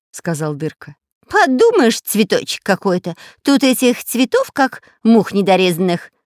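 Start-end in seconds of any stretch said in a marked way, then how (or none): 1.3-6.1 loud, high scornful shout. A narrator's reading. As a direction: yell it out dismissively. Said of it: "выкрикнул разбойник".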